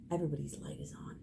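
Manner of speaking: laughing whisper; hushed whisper with a laugh. Real, not acted. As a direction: breathe it out, barely voiced. Soft climax of the image.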